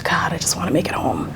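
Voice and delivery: unvoiced, hushed plea under the breath; whispered prayer. Pulled over at night, she whispers a frightened plea. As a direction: whisper the prayer so the officer can't hear.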